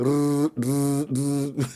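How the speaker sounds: knife dragged over a grater; imitated grating noise. Husband likens nagging to a knife on a grater, imitated grating. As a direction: mimic a rasping grater. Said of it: "like dragging a knife over a grater".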